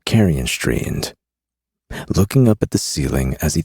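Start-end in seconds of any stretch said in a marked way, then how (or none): none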